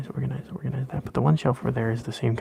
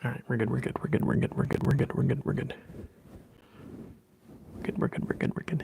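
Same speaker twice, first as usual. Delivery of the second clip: whispering; 8 dB quieter, faster than usual. Acted. ASMR whisper repetition.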